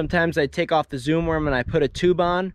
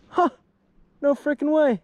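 high-pitched astonishment; gasping disbelief; amazed disbelief; 10 semitones higher than usual. Real, not acted. Eagle grabs a fish.

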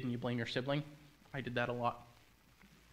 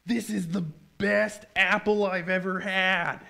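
loud, high, drawn-out raving; delighted Eve; 5 semitones higher, 6 dB louder, slower than usual. Acted. Eve after biting the apple, loud high delighted rave. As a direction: rave slowly and loudly, stretching the words. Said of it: "and she goes".

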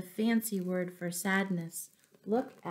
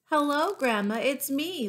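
calling out; 8 semitones higher, 5 dB louder than usual. A narrator's reading.

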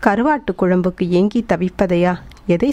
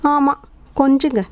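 higher-pitched sarcastic jibe; mock from behind, pitched up; 6 semitones higher than usual. A narrator's reading. Wife mimes behind her husband's back, mocking jibe. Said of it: "Ranjani பின்னால் இருந்து அபிநயம் பிடித்தாள்".

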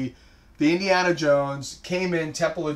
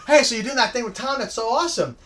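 lightly voiced, higher, quick chirpy wife; husband mimics his wife gushing over a made-up plan, higher and quick; mock chirpy wife; 7 semitones higher, faster than usual. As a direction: play the cheerful wife who thinks she knows. Mock wife playing along.

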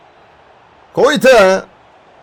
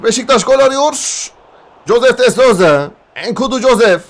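normal talking voice

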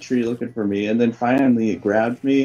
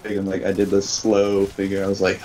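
ordinary talk, nothing marked

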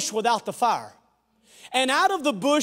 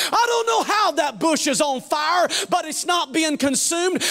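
loud, rising, crying preacher over music; sermon climax, voice breaking toward a shout; full-force preaching; 5 semitones higher, 4 dB louder than usual. Acted. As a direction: preach it out with a catch in the voice.

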